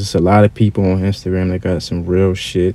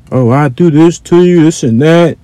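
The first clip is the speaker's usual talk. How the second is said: higher-pitched imitation of a boaster; mock tough talk; 6 semitones higher, 4 dB louder than usual. Keyboard gangster talking behind a monitor, mock tough. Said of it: "They talk all that shit".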